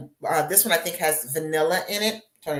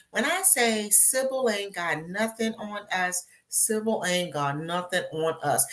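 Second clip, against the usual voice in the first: ordinary delivery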